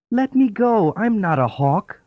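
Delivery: high pleading dove voice; begging dove. A narrator's reading. Trapped dove begs the chicken grower, high and pleading. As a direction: beg for your life, high and small. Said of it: "the dove begged".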